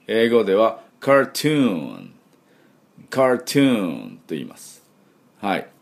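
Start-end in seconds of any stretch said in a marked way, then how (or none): 1.0-4.2 slow "カートゥーン" as English cartoon, long falling oo; "カートゥーン" in careful English. Shown as a demonstration. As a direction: stretch "トゥーン" in "カートゥーン".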